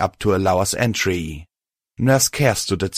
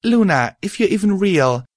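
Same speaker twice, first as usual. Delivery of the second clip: prayer; 11 semitones higher than usual. Acted. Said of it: "gave up a silent prayer".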